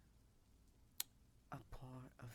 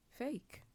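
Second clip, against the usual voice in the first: "fake." pops much higher and louder; stress on fake; 12 semitones higher, 6 dB louder than usual. Confessing to viewers that her stories are fake, "fake." punched high. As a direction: land "fake." as a high, loud reveal.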